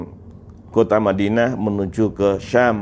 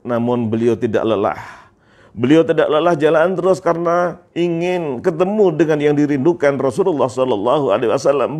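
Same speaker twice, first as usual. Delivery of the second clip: normal talking voice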